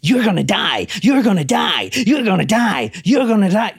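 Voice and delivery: repeated demonic chant, like a stuck record. Retold or imitated. Telling the story, imitates the caller's demonic chant. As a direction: drone it over and over like a demon. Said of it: "like a demon".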